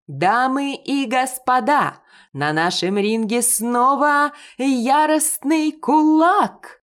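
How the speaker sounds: high, loud, slow announcer call; ring announcer. A narrator's reading. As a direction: stretch it out like a boxing ring announcer. Ring announcer introduces fighter. (голос ринг-анонсера, громко и протяжно)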